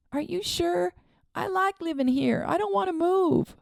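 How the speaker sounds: put-on high small boy voice, worried; a frightened child asking his aunt; young boy Charlie. A narrator's reading. Boy pleads with aunt, high and worried.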